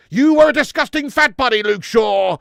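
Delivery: loud, barking drill sergeant. Acted. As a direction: bark it like a boot camp instructor. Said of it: "We need Full Metal Jacket United".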